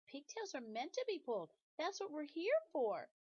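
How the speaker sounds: high matter-of-fact pigtail. Acted. Pigtail explaining to Paul its purpose, high and proud. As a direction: state it proudly in a little high voice.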